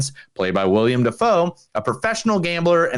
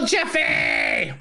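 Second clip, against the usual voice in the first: shout. Pretend. Mock dramatic announcement of beer.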